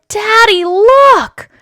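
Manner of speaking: high, loud proud call; excited child. Acted. Taya showing her father the cart, excited.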